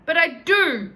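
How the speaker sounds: high, loud, stubborn retort; defiant insistence. Acted. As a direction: insist defiantly like a sulky girl. Chloe insists.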